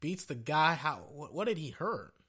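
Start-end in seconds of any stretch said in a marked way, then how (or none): none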